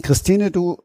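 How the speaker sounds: ordinary delivery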